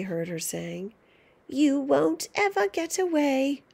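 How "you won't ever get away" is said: taunting witch call. Acted. Grandma calls after George.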